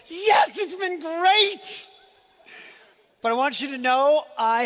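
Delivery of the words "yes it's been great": mock relieved cheer. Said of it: "Some of you are going".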